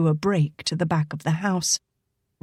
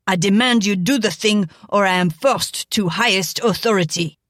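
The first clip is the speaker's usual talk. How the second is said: demanding. Acted. Frenchman demands arrest.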